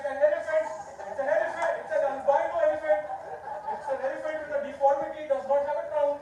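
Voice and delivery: loud, high, gleeful shouting; excited reveal. Real, not acted. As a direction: belt out the reveal like a punchline. Reveal of a badly drawn elephant.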